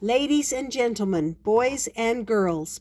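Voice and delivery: grand ringmaster-style announcement; magician voice. Acted. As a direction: open the show like a stage magician. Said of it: "in his very best magician voice".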